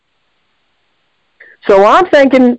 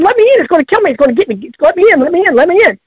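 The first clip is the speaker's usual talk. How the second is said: higher, urgent repeated begging; desperate pleading; 6 semitones higher, much faster than usual. Retold or imitated. Mother begs the kids locked in the car, higher desperate pleading. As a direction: plead to be let in before it kills you.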